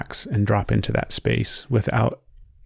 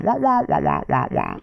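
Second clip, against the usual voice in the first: muffled wah wah talk; 2 semitones higher than usual. Retold or imitated. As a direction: imitate the muffled teacher trombone. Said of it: "it's totally Charlie Brown talking".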